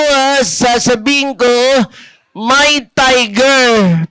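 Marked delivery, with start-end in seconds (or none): none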